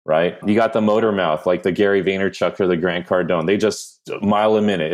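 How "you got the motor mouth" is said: normal talking voice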